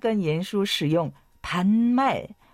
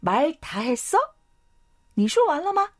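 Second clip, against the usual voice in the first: sharp, much higher mock-angry "말 다 했어？"; angry retort; 8 semitones higher, much faster than usual. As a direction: snap back as the offended boss, voice jumping up. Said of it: "严叔生气地说". (生气地反问，声音陡然升高)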